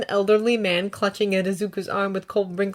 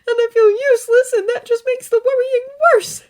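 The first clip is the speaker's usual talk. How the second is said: much higher, tearful; sniffling; 9 dB louder than usual. A narrator's reading. Izuku sniffling to All Might about Ida. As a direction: worry through sniffles.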